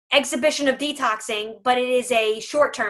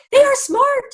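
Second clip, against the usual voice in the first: much higher, louder insistence; emphatic; 12 semitones higher, 5 dB louder than usual. Real, not acted. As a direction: sell how clever dogs are. Insisting dogs are clever.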